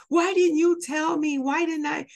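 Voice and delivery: son's loud, high protest at being left out; upset child. Retold or imitated. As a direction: cry out like a hurt little boy. Son upset he wasn't told.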